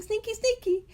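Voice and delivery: very high teasing singsong; playful sneaky. Real, not acted. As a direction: tease it like a secret. Playful aside on sneaky prayers.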